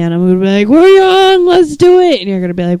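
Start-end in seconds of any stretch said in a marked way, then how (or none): 0.7-2.2 excited shout. Pretend. Drunk self proposing walk.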